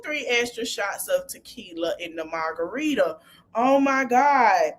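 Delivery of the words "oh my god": usual speaking voice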